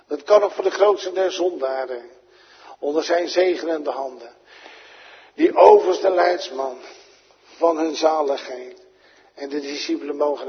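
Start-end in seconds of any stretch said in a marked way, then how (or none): 0.1-8.9 ringing pulpit appeal; fervent preaching. Acted. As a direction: declare it to the whole congregation. Preacher proclaims grace.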